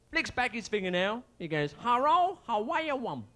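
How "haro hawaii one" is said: mock Japanese accent. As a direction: put on a hammed-up Japanese accent. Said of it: "the Japanese chap puts his finger up".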